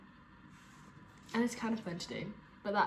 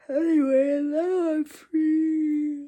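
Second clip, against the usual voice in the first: crying; 5 semitones higher, 12 dB louder, much slower than usual. Real, not acted. Emotional ending.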